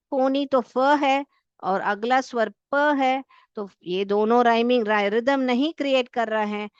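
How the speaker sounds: normal talking voice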